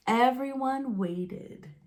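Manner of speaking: ordinary delivery, nothing marked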